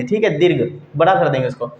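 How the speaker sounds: ordinary talk, nothing marked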